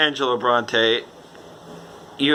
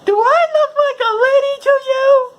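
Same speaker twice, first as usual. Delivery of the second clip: very high, loud squeaky indignant voice; offended lady; 6 dB louder than usual. Retold or imitated. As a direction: squeal it like an affronted woman. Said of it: "the lady goes".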